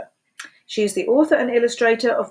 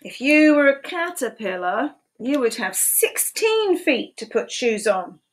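lively picture-book read-aloud, questions pitched high; animated storytime voice with playful questions; reading to children; 6 semitones higher than usual. Acted. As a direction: read it like storytime for little ones.

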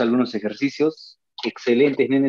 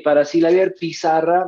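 ordinary talk

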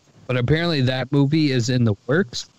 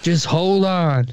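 drawn-out, higher mock-parent plea; lightly acted dad slowing his son down; stalling dad; 4 semitones higher, slower than usual. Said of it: "And you're like".

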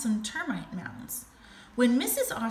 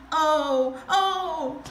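higher, drawn-out fake moan; sad moaning; 5 semitones higher, 5 dB louder than usual. A narrator's reading. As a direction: moan like a crocodile faking pain. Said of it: "Crocodile said sadly".

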